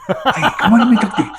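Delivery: high-pitched cartoon dog voice; Beverly Hills Chihuahua. Voice actor proving himself to a kid, high Chihuahua voice. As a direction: put on the high movie Chihuahua voice. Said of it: "It's the same fucking voice".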